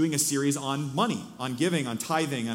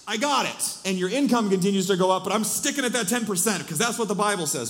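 higher, louder mimicked insistence; put-on self-satisfied protest, pitched up; mock rigid giver; 6 semitones higher, 4 dB louder than usual. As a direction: act out someone stubbornly sticking to a rule.

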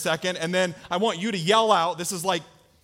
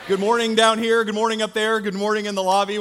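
preaching; 2 semitones higher, 5 dB louder than usual. Acted. Pastor's loud opening greeting.

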